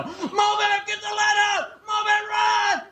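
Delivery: high, bellowing coach shout; coach yelling. Retold or imitated. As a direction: bark it across the field like a gym coach. Coach shouting at slow kids.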